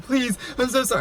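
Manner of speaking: high, soft pleading whine; hammed-up sorry plea; mock crying. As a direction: imitate a tearful ex begging. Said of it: "he's over there crying".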